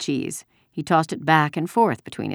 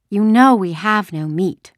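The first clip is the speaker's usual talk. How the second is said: slow, louder, higher cheeky retort; insolent; 6 semitones higher, 8 dB louder than usual. A narrator's reading. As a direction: answer back like an insolent girl. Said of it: "she said insolently".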